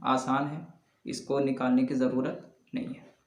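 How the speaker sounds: usual speaking voice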